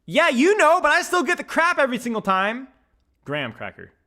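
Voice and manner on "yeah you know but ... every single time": much higher and louder complaint; venting about getting grief every time; exasperated loud. Real, not acted. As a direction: complain loudly, fed up.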